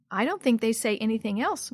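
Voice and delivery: usual speaking voice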